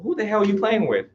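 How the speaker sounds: high-pitched mock indignation; mock indignant dog. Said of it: "She's not like".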